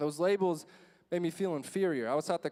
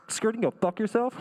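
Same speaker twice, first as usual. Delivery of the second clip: loud, higher mocking retort; defiant radio call; 4 semitones higher, 7 dB louder than usual. Student on principal's walkie-talkie to security, defiant shout.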